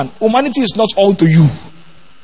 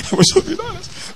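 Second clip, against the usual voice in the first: higher and softer, through a laugh; amused half-laughing retake; laughing repeat; 12 semitones higher, 6 dB quieter than usual. Shown as a demonstration. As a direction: let the laugh carry the words.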